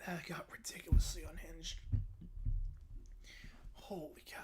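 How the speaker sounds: hushed whisper; amazed whisper. Real, not acted. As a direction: breathe it out in disbelief. Stunned after story.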